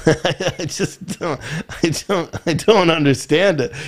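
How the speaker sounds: slow, trailing words through a real laugh; real laugh, can't finish the sentence. Real, not acted. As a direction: laugh it out as words fail you. Laughing at the absurd story.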